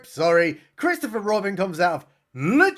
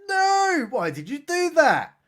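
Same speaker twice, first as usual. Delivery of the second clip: high, hammed-up anguished cry; mock anguish; 9 semitones higher than usual. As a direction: wail it like a grieving hero. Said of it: "he's like".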